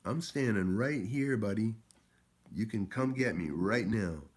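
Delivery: mock yelled dare; retold challenge. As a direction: call him out across the street. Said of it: "And I'm like".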